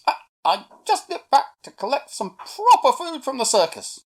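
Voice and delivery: high, loud, eager monkey; high-pitched boastful little monkey; Millie the monkey, pleased with herself. A narrator's reading. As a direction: burst in with news, tripping on the first word. Monkey returns with food.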